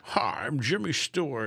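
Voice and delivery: mock his own corny celebrity bit; Jimmy Stewart impression. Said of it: "whenever I do an impression".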